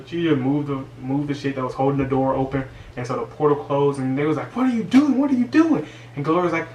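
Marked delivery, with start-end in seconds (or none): 4.6-5.9 high, loud alarmed cry; panicked. Retold or imitated. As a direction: shriek it as the door slams shut. Girls panic as portal closes.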